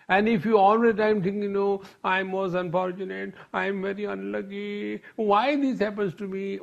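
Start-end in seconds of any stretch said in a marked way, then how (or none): 2.0-6.6 hammed-up poor-me moan; mock self-pity. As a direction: lament your bad luck in a put-on higher voice. Mimics a self-pitying complainer.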